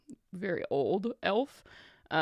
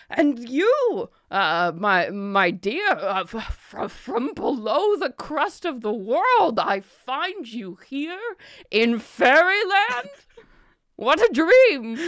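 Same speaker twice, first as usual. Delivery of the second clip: higher, louder, slow wonderstruck flourish; theatrical merchant; 7 semitones higher, 6 dB louder, slower than usual. Acted. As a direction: marvel at her grandly. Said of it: "he turns to you".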